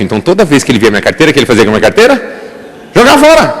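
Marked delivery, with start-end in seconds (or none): 3.0-3.5 loud exclamation. Acted. Pastor shouting the unconscious mind's answer, loud.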